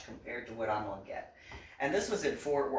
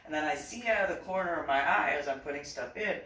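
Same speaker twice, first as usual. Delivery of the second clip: usual speaking voice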